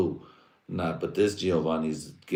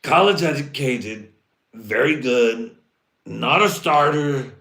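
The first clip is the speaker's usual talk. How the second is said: mock hype; 7 semitones higher, 9 dB louder, slower than usual. Raised mock praise.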